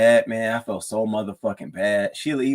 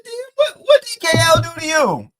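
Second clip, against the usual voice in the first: much higher, loud disbelieving yell; shocked shout; 7 dB louder than usual. Retold or imitated. Streamer reacting to Lemon insulting D K L, shocked shout. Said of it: "I said".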